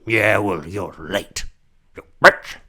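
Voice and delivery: cranky, building to a yell; angry old man. Acted. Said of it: "He's probably in his wheelchair like".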